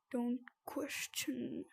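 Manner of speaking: slow whispered warning. Pretend. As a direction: breathe it slowly.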